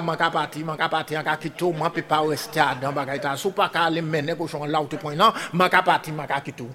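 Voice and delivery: soft, low-voiced wife; quiet resolve. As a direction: say it low, half to herself. Madame says she is leaving.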